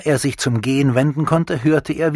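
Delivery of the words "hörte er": usual speaking voice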